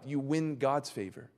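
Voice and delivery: normal talking voice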